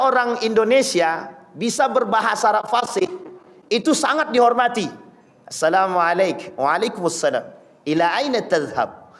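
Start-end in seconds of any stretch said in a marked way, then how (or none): 5.5-9.1 low, careful classical Arabic; fluent Arabic greeting. Shown as a demonstration. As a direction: say it like a well-read student. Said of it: "Itu seolah-olah bagi mereka, wow".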